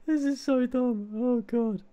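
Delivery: high laughing disbelief. Real, not acted. Making a silly meme for a friend, high and laughing. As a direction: squeak it out through laughter.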